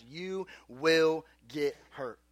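slow, word-by-word warning; grave warning. Real, not acted. Preacher warning teens about foolish friends, grave and slow. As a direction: space out every word deliberately.

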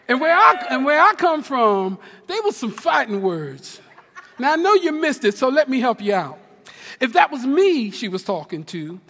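usual speaking voice

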